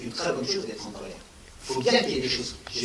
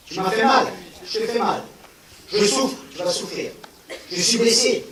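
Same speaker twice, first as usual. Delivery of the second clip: raised, higher, heated lines of a wounded man striking back; hurt revenge voice; 6 semitones higher, 5 dB louder than usual. Retold or imitated. Speaker voicing someone hurt who hits back, louder and higher heated tone. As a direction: play the hurt one who answers pain with pain, louder.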